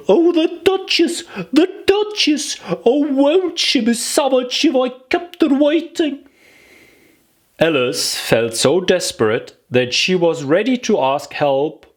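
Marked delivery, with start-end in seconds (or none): 0.1-6.3 high, frantic little rabbit voice. Acted. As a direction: panic about being late. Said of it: "muttering to himself as he came".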